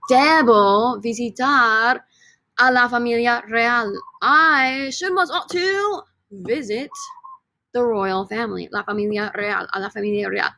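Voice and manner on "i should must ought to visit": high put-on British accent. Shown as a demonstration. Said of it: "Say it with British accents".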